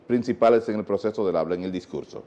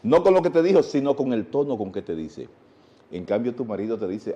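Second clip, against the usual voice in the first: usual speaking voice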